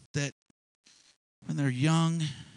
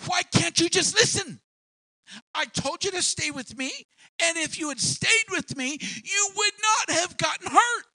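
loud, higher exasperated scolding; scolding parent; 5 dB louder than usual. Retold or imitated. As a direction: tell off the stubborn child. Parent scolding hurt child.

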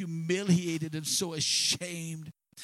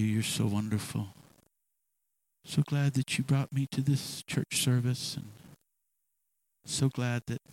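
smug mock prayer; 7 semitones lower than usual. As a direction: pray like a smug churchgoer, only a hint of mockery. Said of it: "Patty cake little service".

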